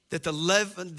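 normal talking voice

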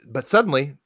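normal talking voice

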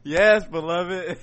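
mock pastor. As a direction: draw it out like a pastor to the flock. Playing her pastor after teasing.

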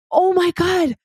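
high, breathless burst of wonder; awestruck gasp. Retold or imitated. Reaching the summit, amazed at the view, awestruck. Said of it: "I'm like".

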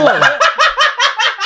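amused high-pitched laughing. Real, not acted. Reacting to pesantren joke.

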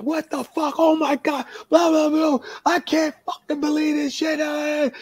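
high-pitched ranting outburst; retold furious high yell; cussing fit. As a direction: imitate a man storming in cussing, voice way up high. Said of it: "he's kicking shit and he's cussing".

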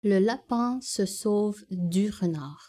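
another try in Parisian French; France French accent. Shown as a demonstration. Correcting the take with a France French accent. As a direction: redo it the way they say it in France. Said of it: "It would be like".

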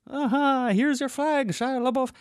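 very high, put-on taunting voice; squeaky mocking taunt at Shia LaBeouf; mock 4chan guy. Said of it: "They're like".

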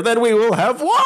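laughing while mimicking a yell heard from outside; echoing shout heard through a door, played for laughs; distant war cry through laughter. Friend imitates the war cry echoing through the room, laughing. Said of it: "and I just hear".

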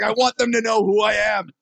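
hammed-up show-off proclamation; mock grandstanding. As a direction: announce yourself with mock importance. Mocking an attention-seeking contestant.

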